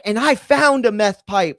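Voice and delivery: high, loud, accusing rant; mock yelling. Landlord ranting random accusations over the phone, mock yelling. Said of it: "Just yelling over the phone".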